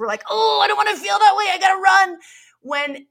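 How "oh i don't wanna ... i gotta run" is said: high, loud and fast, a hammed-up panicky yell; mock panic. Hiding from the delivery guy, a hammed-up panicky high yell. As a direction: yelp it out like you're bolting from the door. Fleeing from the emotion.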